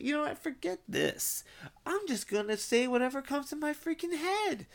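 high-pitched, exasperated declaration; mock defiant outburst; fed-up resolve. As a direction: throw caution away mid-rant. Acting out deciding to stop filtering.

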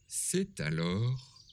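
ordinary delivery

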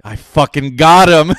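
loud, drawn-out mock triumph; retold gloating shout. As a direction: crow it slowly and loudly, as the prankster. Prankster's triumphant reveal, retold.

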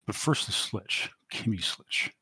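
soft, nearly whispered angry mutter. Acted. Mister Johnson hailing a cab, muttering her name. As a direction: hiss the name under your breath. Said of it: "He continues to mutter".

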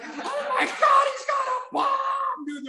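extremely high, stretched-out shriek; mock panicked scream. Airport cops panicking at a passenger, hammed-up screaming. Imitates panicked reaction to his shout.

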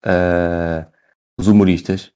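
ordinary delivery

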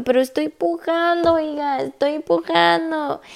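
strained mock complaint, stretching the last words; retold whiny protest. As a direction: imitate yourself protesting to the nurses. Acts out her protest to nurses.

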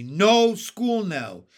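slow, loud, high protest; emphatic refusal. A narrator's reading. Small boy objecting to school, slow loud protest. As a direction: stretch each word in loud objection.

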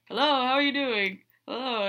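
high, loud, showy greeting to a stunned crowd; loud cheery hello. Retold or imitated. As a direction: barge in grinning and hail everyone. Hero crashes wedding on horse.

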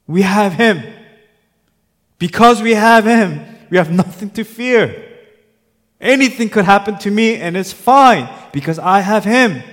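loud, high, impassioned sermon cry; preacher rising to a high, forceful peak; full-force preaching. Real, not acted. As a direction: proclaim it loudly to the whole congregation.

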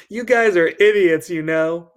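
loud high angry scream at the bullies. Acted. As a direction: yell it at them with everything you have. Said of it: "I screamed".

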